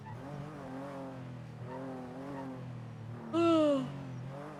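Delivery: slow, heavy sigh out; long sigh. Real, not acted. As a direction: let out a long weary breath. Sigh after holy shit.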